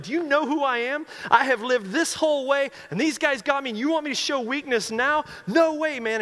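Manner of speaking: Rocky shouting his refusal at the priest, furious; angry defiance. Retold or imitated. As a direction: rage against the request. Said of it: "puts up this whole fight".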